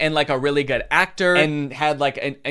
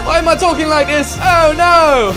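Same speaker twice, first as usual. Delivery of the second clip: much higher, louder mock-panicked squeak; 11 semitones higher, 9 dB louder than usual. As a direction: panic at yourself, hammed up. Panicked auditioner imitated.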